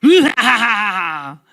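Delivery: slow, hammed-up booming cackle; drawn-out mocking belly laugh; Goliath's villain laugh. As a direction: laugh scornfully like a giant warrior. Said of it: "이렇게 막 웃는 거예요".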